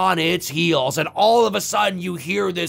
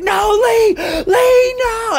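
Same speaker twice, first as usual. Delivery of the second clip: high, loud, drawn-out cry of horror; anguished scream; 13 semitones higher, 6 dB louder, much slower than usual. Acted. Lori watches Lee get stabbed, screaming in anguish. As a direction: wail the name in acted desperation.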